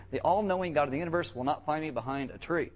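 usual speaking voice

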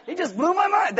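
high-pitched mock amazement; Kramer. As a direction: marvel high, as a stunned sitcom neighbor. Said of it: "are all Kramers".

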